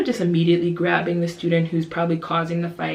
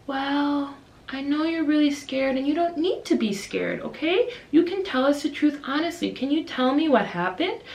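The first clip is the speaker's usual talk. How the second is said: patient, soothing preschool-teacher voice; calm teacher; 7 semitones higher than usual. Retold or imitated. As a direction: gently coax the truth out of a little one. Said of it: "me and my co-teacher are just so calm".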